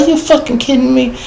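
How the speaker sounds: high, incredulous chewing out; retold angry disbelief. Supervisor chewing out his crew for no extinguishers, high angry disbelief. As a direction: rip into them, stunned.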